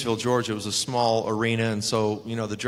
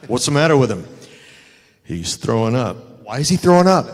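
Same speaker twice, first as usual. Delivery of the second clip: retold loud questions; 11 dB louder than usual. Sting asks about noise, retold.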